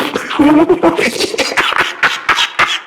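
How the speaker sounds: breathy high cackle; giddy villain cackle; high unhinged laugh. Acted. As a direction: let the madness spill out in a laugh. Can't control himself.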